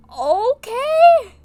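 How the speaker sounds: loud high startled okay; surprised. Real, not acted. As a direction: blurt it out in shock. Startled reaction to story.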